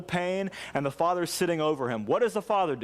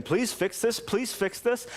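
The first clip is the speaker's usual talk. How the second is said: retold plea to God; 7 semitones higher, slower than usual. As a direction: replay a desperate prayer with restraint. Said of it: "crying out to God".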